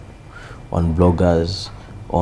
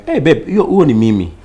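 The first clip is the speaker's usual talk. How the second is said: lightly voiced female viewer, higher and louder; women recognising themselves; 7 semitones higher, 8 dB louder than usual. Women viewers telling their partner about the sketch, lightly imitated exclamation. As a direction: call out as an imitated fan. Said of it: "some of them are saying".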